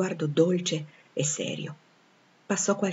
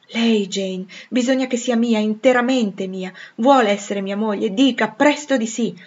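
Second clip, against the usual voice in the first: Rochester insisting, higher and louder; urgent suitor; 4 semitones higher, 6 dB louder than usual. Acted. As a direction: press urgently for a yes. (innamorato che incalza con foga)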